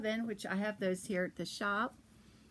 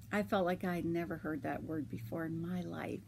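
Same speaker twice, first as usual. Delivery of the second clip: ordinary talk, nothing marked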